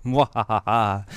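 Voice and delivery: slow evil cackle; gloating villain laugh, drawn out; sneering panto villain laugh. Acted. Halloween news intro, evil laugh.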